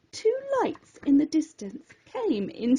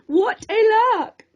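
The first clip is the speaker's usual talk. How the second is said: laughing; 7 semitones higher, 5 dB louder than usual. Acted. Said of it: "she laughed".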